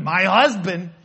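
imitating an annoyed spouse; mock irritated spouse. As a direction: spit out the title like you're fed up. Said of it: "when you're mad at your husband".

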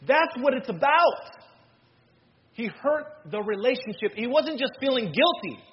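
impassioned preaching. Real, not acted. Preacher explaining to the congregation why Peter wept, impassioned. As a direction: preach it with rising urgency, leaning on key words.

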